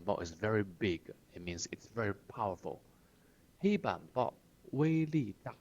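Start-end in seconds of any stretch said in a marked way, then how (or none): 3.6-5.5 黑板报威力大 raised like a cadre; pompous party-speak; official tone. Retold or imitated. Teacher repeats cadre's boast, in official tone. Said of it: "官腔".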